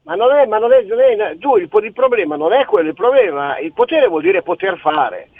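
ordinary delivery

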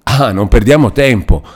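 loud, eager, urgent cry; hurry, breathless with pity; urgent exclamation. A narrator's reading. Federigo urges haste to save Lucia, loud and eager. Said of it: "esclamò Federigo, ansante di pietà".

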